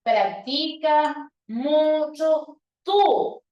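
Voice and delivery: practica mucho tú drawn out slowly, tú falling; slow teacher demo; very slow, stretched. Shown as a demonstration. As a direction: spell it out slowly to the class. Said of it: "eso es equivalente a decir".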